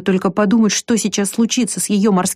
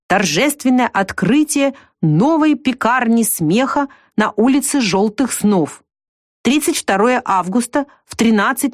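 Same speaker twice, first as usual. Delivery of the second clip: higher, slow festive announcer; announcement; 4 semitones higher than usual. Acted. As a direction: read it like a grand ad, slowly. Girl reads a festive poster.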